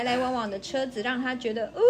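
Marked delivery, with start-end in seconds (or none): none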